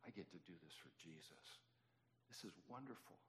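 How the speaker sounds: hushed, awed delight; quiet rejoicing. Retold or imitated. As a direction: marvel very softly at the privilege. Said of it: "I was rejoicing".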